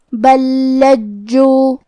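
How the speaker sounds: بل لجو with the two لام joined into one doubled لام; merged lam. Shown as a demonstration. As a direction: join بل into لجو. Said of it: "ایک ہی لام اور تشدید کے ساتھ". (بل لجو میں ادغام)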